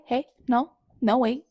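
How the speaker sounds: ordinary delivery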